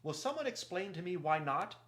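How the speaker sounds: high, loud indignant question; clear-voiced Reepicheep. A narrator's reading. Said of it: "the clear voice of Reepicheep broke in".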